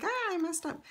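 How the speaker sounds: high-pitched little wail at own slip; hammed-up "oops" reaction; mock dismay. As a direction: playfully lament your own mistake, pitched high. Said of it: "I'm like".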